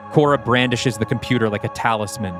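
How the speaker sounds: normal talking voice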